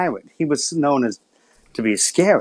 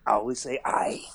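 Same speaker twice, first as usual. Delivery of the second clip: whispered; 8 dB quieter than usual. Real, not acted. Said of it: "you never say yes".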